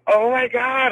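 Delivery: high, loud star-struck gasp; excited oh my God. Retold or imitated. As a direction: gush it like a starstruck fan. Meeting Leroy.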